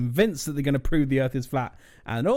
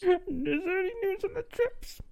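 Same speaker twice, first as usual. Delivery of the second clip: character voice; 5 dB quieter than usual. Acted. Player voicing a character.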